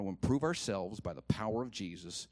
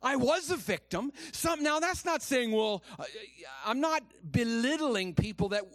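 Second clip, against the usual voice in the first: high, raised preaching voice; impassioned preaching; 11 semitones higher, 5 dB louder than usual. Real, not acted. Preacher urging the hurt not to stay victims, impassioned preaching. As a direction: press the point home from the pulpit.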